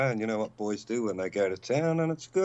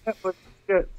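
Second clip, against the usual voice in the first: mock viewer comment; 6 semitones higher, faster than usual. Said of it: "just say".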